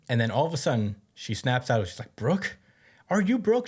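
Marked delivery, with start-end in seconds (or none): 2.1-3.7 high-pitched, rising question; acted startled recognition. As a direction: ask it as if suddenly recognizing someone. Acts girl suddenly recognizing Brook.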